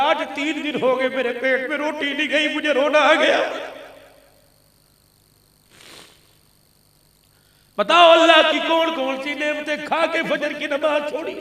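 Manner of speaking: emotional sermon appeal; impassioned preaching. Real, not acted. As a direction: build to loud cries, reproaching the congregation. Fervent reproach from the pulpit.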